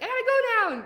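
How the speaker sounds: mock panicked yelp, high and loud; a hammed-up flustered escape; panicked bailout. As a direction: bail out of the live in a mock panic. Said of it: "sweat and go red and be like".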